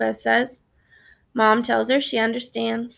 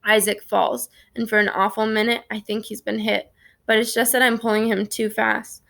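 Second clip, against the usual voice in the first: usual speaking voice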